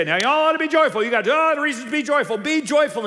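high, loud, rapid pulpit cheerleading; hammed-up preacher urging everyone on; exhorting pastor. Pastor mocks his own kind urging joy, high loud exhortation. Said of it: "we pastors would get up here and say".

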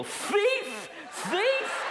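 high, shrieking accusation; shrill accusing cry. Retold or imitated. Accusing the thief.

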